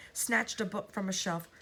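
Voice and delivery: ordinary delivery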